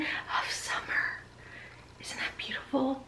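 soft hushed whisper in awe. Real, not acted. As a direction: whisper as if the colour might break. Whispers while admiring the colour.

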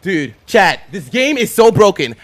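loud frustrated rant; raging gamer. Real, not acted. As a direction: yell it at the chat. Ranting to chat after dying.

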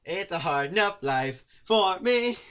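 high, loud, slow melodrama; hammed-up lament. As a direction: wail it like a tragic hero. Dramatic lament line.

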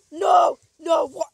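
real frustrated scream; anguished shout. Real, not acted. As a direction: yell at the screen as you die. Character dies in game.

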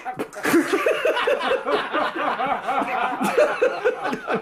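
high helpless laugh; burst of laughter. Acted. Medical student laughing at the inspector's news. Lestrade mentions severed ears.

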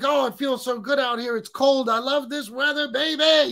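a light send-up of an overjoyed pal; mock happy friend. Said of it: "he's all happy".

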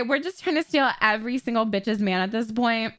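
normal talking voice

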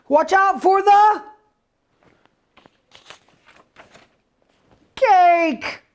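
put-on high little-girl warning. Girl warning Biscuit near the cake, high voice. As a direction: warn the puppy in a high voice.

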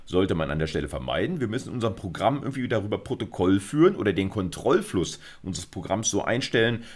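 usual speaking voice

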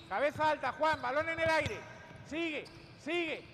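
loud shouted commands; barking gym coach. Real, not acted. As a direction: bark it at the players. Coach calling during drill.

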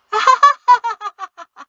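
high, loud, forced laugh; rigid laugh. A narrator's reading. Mother laughs stiffly at the tense family, high forced laugh. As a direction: break the tension with a stiff laugh. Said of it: "Mari's rigid laugh".

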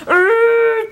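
loud, high mock effort grunt; hammed-up heaving "urgh"; imitated straining grunt. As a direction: imitate a toddler shoving with all her might. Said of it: "as hard as she can, and she goes".

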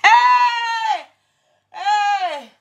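high laughing shout; laughing hey; laughing exclaim. Real, not acted. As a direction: burst out laughing. Laughing at own story.

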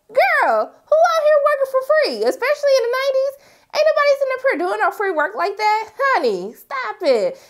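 sass her with disbelief; sassy disbelief. Retold or imitated. A viewer scoffing at free legal work, high and sassy. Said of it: "And I was like".

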